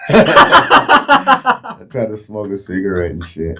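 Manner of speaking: slow words through laughter; laughing. Real, not acted. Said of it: "laughing while telling".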